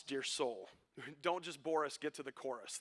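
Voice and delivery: normal talking voice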